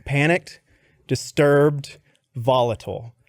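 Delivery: reading word by word, long pauses; halting read-out. Retold or imitated. Candidate reads his weaknesses to the boss, halting. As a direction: read each word out separately, stepping down at the end. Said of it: "and it says".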